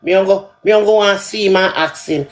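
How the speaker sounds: ordinary talk, nothing marked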